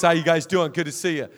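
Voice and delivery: low, jovial mimicked greetings; father-in-law impression. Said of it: "And he talks like this".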